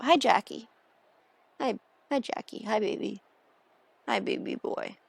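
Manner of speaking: soft, tender pet talk; cooing to pet. Real, not acted. Streamer greets Jack who just jumped up, cooing softly. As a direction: coo softly to a beloved pet.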